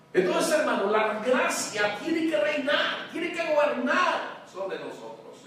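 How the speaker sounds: loud, high, driving sermon voice; fiery preaching. Acted. Pastor preaching to the church, loud and fiery. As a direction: hammer it home to the congregation.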